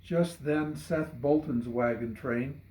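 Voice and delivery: plain reading voice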